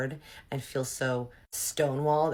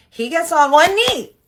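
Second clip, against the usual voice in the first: very high, loud, drawn-out yell; shouted disbelief; 15 dB louder, slower than usual. Real, not acted. Telling viewers her ex knelt to propose, shouted disbelief. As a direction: holler it slowly, utterly scandalized. Repeats shocking reveal.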